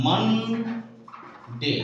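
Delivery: Monday stretched very slowly; drawn out model word. Shown as a demonstration. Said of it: "সঠিক উচ্চারণটা হবে". (টেনে টেনে মানডে)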